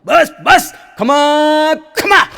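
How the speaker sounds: high loud possessed cry; hammed-up trance shout; mock yell. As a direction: howl it out in a trance. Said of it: "બેને રાડ પાડી".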